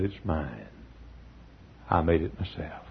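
almost a hush of pride; quiet heartfelt claim; tender friend. Retold or imitated.

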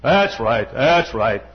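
deep voice, THAT'S hit hard; low, emphatic pulpit impression; Lee Robertson. Showing how an admired preacher emphasized first words, deep. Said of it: "Always emphasized the first word".

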